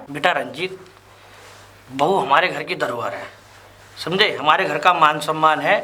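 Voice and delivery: a father calming his son gently; softened fatherly tone. Acted.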